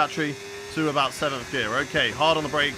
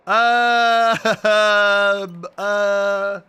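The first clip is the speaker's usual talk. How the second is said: bursts out laughing before answering; real laugh at the question; 4 semitones higher, 6 dB louder than usual. Real, not acted. Streamer laughing at a chat question.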